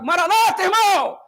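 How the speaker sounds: high, loud call across the aisle; mock hearty greeting. Adventist greeting a brother in the supermarket, loud. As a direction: call it out cheerfully.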